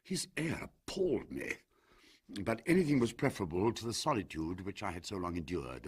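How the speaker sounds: plain reading voice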